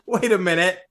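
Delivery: offended Latvians. Acted. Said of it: "everyone from Latvia is like".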